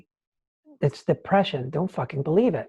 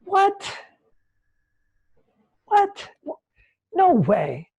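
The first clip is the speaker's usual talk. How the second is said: much higher, stunned double what; amazed disbelief; 11 semitones higher, much slower than usual. Retold or imitated. Recalling his first clean run through the trees, high with disbelief. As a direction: sound like you can't believe it.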